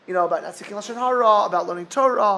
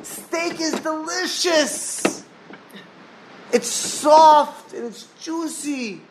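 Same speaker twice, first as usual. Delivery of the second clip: put-on pleading boy; 7 semitones higher than usual. Yeshiva boy coaxing the old sage to try steak, high and eager. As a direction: coax him like a kid selling a treat.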